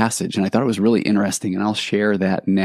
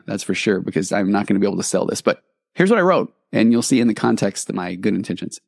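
ordinary talk

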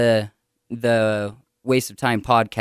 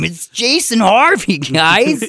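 higher, throaty-nasal show intro; 9 semitones higher, 4 dB louder, slower than usual. Shown as a demonstration. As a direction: keep the gross throaty nasal sound going. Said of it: "throaty and nasally at the same time".

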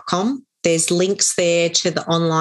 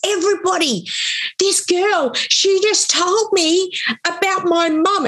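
imitated amazed announcement; 13 semitones higher than usual. Boxer telling the whole table about her, imitated amazement. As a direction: act out an astonished man addressing the room.

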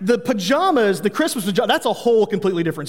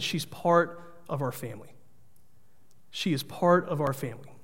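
soft, gentle and quiet; hushed comfort to a child; tender reassurance; 4 semitones lower, 10 dB quieter than usual. Retold or imitated. Father reassuring tearful daughter.

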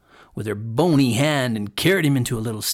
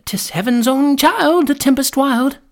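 put-on children's voices; 13 semitones higher, 6 dB louder than usual. A narrator's reading. Children answer the witch from outside, childlike. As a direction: answer as a little child, pitched high. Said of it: "The children answered".

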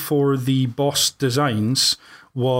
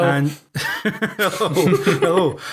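laughing; 4 semitones higher, much slower than usual. Real, not acted. Greeting guests while laughing.

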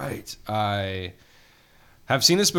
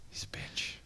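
whispering; 14 dB quieter than usual. Real, not acted. Aside insult about Ray.

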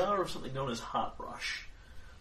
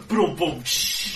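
mock drum sounds; 4 semitones higher, 10 dB louder, much slower than usual. Mimicking campfire drums.

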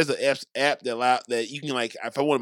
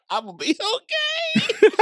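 high, laughing quote; quoting while laughing; 4 dB louder than usual. Retold or imitated. Cohost quotes host's line, laughing high. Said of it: "He said".

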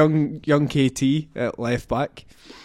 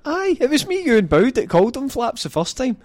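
indignant high; 10 semitones higher, faster than usual. Real, not acted. Indignant defence of nickname.

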